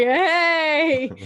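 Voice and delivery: loud, drawn-out, high hail; retold excited greeting. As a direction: call out to acquaintances, hammed up. Retold greeting at awards show.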